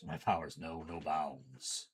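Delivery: mock villain boast.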